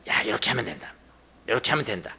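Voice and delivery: low, soft schemer's aside; imitated secretive whispering. Preacher plays a schemer advising someone, hushed. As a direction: murmur it low, like slipping someone a plan. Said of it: "속닥속닥해요".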